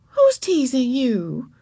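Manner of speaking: drawn-out kindergarten teacher voice; concerned teacher. A narrator's reading. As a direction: ask an upset child gently and slowly. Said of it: "asked Miss Binny".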